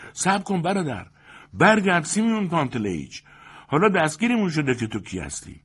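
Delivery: very high, loud, urgent begging; pressing, high-pitched entreaty; insistent pleading. A narrator's reading. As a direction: insist that he must come back. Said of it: "ساقدوش با اصرار گفت".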